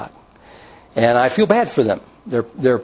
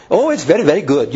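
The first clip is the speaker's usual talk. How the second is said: put-on Indian accent; 2 semitones higher than usual. Pastor imitating a tech support caller, light Indian accent. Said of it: "he's got that accent".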